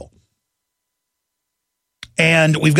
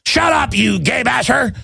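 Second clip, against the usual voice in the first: higher, slower mock shout of a critic; mock yell; 7 semitones higher, slower than usual. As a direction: yell it like a hammed-up heckler. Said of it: "They're like".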